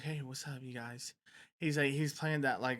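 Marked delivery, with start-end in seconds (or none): 0.0-1.1 a soft, timid little greeting; mousy soft voice. Retold or imitated. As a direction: say it tiny and shy like a mouse. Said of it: "He sound like a mouse".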